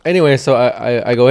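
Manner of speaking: ordinary delivery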